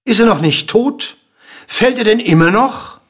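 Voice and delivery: loud, high, incredulous questions; impatient calling. A narrator's reading. King impatient with the dwarf's endless fall, calling out. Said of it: "rief der König".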